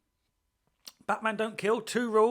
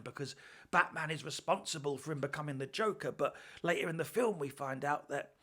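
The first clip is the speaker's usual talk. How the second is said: ordinary talk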